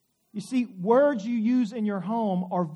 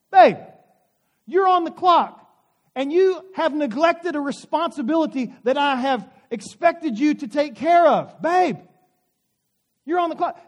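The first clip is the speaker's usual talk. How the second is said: loud, higher-pitched, stern reprimand; scolding wife; 6 semitones higher, 9 dB louder than usual. Retold or imitated. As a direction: lay down the law to a slacking husband. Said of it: "Scott, you are on the clock".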